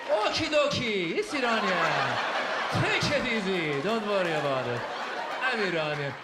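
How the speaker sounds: slow, drowsy Iranian accent; slow Persian accent. Shown as a demonstration. Mocking how Iranians talk, slow sleepy Persian accent. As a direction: drag each word like you're falling asleep. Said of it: "Iranians talk like this".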